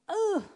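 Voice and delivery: ugh, high pitched groan; playful ugh of dread; reluctant groan. Acted. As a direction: groan at the hard part. Reacting to hard command.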